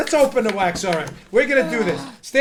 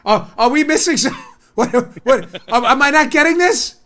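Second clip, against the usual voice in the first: halting mock bewilderment, rising at the end; confused jury. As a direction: stammer like jurors who can't follow. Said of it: "The jury would be like this".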